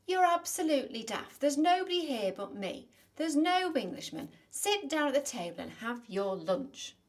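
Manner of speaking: giant's wife snapping back at him loudly; scolding old lady. Acted. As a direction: tell the big oaf off, loud and high.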